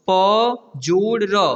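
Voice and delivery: letters joined very slowly; slow spelling out. Shown as a demonstration. Teacher spelling a word for pupils, very slowly. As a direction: sound out each letter very slowly. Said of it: "पर".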